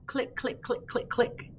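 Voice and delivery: whispered high "Click, click" like snapping claws; clicky claw sounds. Shown as a demonstration. Librarian showing kids the crab claw sound. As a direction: tap out "click" softly, crab claw style. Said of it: "Can you do that?".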